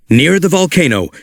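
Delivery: ordinary delivery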